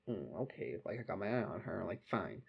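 soft, low resigned aside; resigned mutter. Retold or imitated. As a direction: mutter it under your breath. Resigned muttering as friend runs off.

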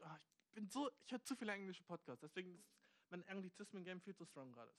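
ordinary talk

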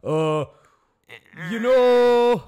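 high, shouted goofy voice; Canadian Beavis voice. Retold or imitated. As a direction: blurt it like a dim cartoon teen. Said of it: "Canadian Beavis voice".

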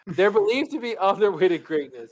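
loud, high, pompous reading; mock grand reading. Shown as a demonstration. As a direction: read it out grandly, hammed up. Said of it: "I like my version better".

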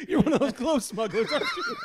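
laughing mock accusation. Teasing friend as a glove smuggler, laughing high and loud. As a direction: squeal the accusation through laughter. Repeats cop joke laughing.

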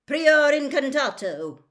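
high, loud roared incantation. A narrator's reading. Mister Diggory casting a spell on the wand, roared. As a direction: bellow the spell out. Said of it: "roared Mister Diggory".

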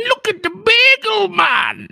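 slow, very high falsetto squeal; mocking gawker. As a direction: jeer like a delighted gawker, drawn out. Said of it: "looking at this guy and going".

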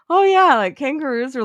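high, loud burst of fake discovery; hammed-up "oh yeah" with a lift; mock realization. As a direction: act out the sudden penny-drop. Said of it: "'cause I was like".

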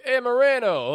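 put-on loud, high Italian accent greeting. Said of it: "I said something in the Italian accent".

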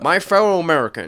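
high, loud fake Chinese accent; Chinese-accented Obama. Friend trying his own Chinese Obama, loud mock accent. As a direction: presidential address in a badly faked Chinese accent. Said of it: "is that a good Chinese Obama".

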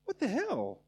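protest, stung and confused; high-pitched mock indignation; indignant surprise. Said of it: "you're like".

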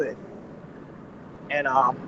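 ordinary talk, nothing marked

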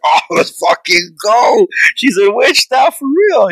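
high, drawn-out excited yell; excited cheering. Acted. Viewer cheering on a hoped-for witch pact, excited high-pitched shout. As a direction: whoop it up like a thrilled moviegoer. Imagining own excited reaction to movie.

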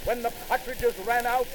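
plain reading voice

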